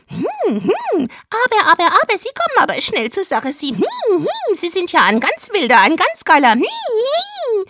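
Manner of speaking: high squeaky porn dub, racing in places; squealing dubbed housewife. Acted. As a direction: overplay the shocked flirt.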